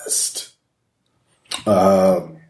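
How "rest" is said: unvoiced, breathy ghost word; ghostly whisper. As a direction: breathe it like a dying spirit. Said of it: "that's what it says".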